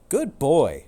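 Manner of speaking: loud, upbeat pet owner; praising owner. Acted. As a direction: cheer the dog on.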